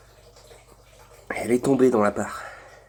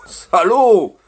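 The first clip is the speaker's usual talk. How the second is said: loud shout; 9 semitones higher, 10 dB louder than usual. Real, not acted. Shouting at the sauce.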